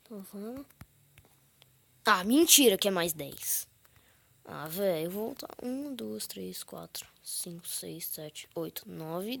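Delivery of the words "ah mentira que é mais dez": loud frustration. Real, not acted. Frustrated at penalty in game.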